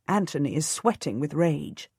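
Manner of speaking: ordinary delivery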